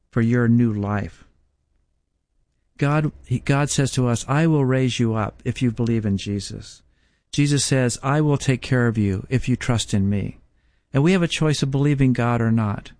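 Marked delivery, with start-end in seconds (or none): none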